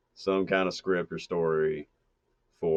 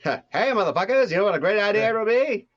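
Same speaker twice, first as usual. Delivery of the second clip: loud, very high wizard voice; 14 semitones higher, 6 dB louder than usual. Acted. Wizard popping in on the hosts, high loud cartoon voice. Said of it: "the wizard from The Wizard of Oz".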